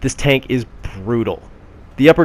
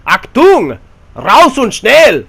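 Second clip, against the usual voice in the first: barked German commands, much higher and louder; a cartoon German officer yelling orders, slowly; shouted German accent; 12 semitones higher, 10 dB louder, much slower than usual. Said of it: "my horrible German accent".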